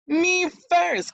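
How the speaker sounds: high, loud grabby shout; eager cry. A narrator's reading. Eldest stepsister pushing forward to the prince, high and loud. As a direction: push in ahead, shrill and loud. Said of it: "cried the eldest stepsister".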